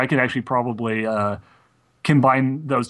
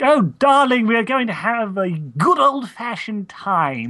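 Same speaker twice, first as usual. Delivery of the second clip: high, slow posh voice with a Southern drawl; a fancy lady inviting you to a hoedown; posh Southern Rarity; 9 semitones higher, slower than usual. Retold or imitated. Imitating Rarity's posh Southern episode voice. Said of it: "Rarity was doing".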